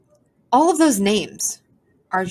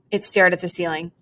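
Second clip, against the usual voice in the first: plain reading voice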